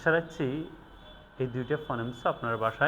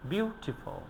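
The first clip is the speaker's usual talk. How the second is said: high "বিউ", soft falling "টিফুল"; stress on first syllable; 8 semitones higher, 5 dB quieter, slower than usual. Shown as a demonstration. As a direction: lift "বিউ" in "beautiful", ease off after. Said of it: "উইক সিলেবলে উচ্চারণ করেছি". ("বিউটিফুল"-এ "বিউ" উঁচুতে, "টিফুল" নরম)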